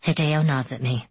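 ordinary delivery, nothing marked

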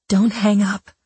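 shaky whisper. Pretend. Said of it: "Patricia whispered in a shaky voice".

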